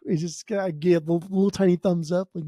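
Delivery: normal talking voice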